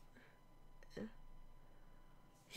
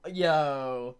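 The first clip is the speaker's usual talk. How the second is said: ordinary delivery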